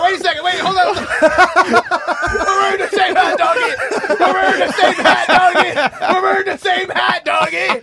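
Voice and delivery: high, loud, laughing delight; excited. Real, not acted. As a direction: shout it in joyful surprise. Excited about matching hats.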